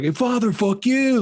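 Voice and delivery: high-pitched mocking biker jeer; taunting. Biker jeering at a man dressed as priest, mock taunting. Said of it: "like, taunting him".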